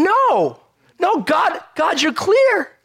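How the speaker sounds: high, loud, slow pleading cry; earnest cry to God. Retold or imitated. Believer firmly refusing temptation, crying out to God. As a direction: plead with God, drawing out clear.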